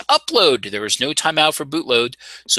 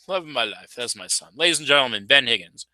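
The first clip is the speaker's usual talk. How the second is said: ordinary delivery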